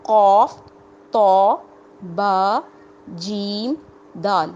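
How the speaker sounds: each letter said separately and firmly; letters called out. Shown as a demonstration. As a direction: call out the five letters one by one. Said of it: "قطب جد".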